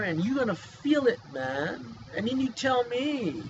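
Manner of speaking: normal talking voice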